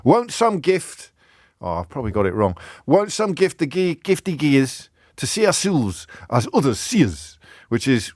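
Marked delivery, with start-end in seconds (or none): 0.0-1.1 high, drawn-out Scots recitation; Scottish accent. Acted. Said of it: "compliments on my Scottish accent". 2.9-7.4 high, put-on Scots poetry voice; Scottish accent. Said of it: "compliments on my Scottish accent".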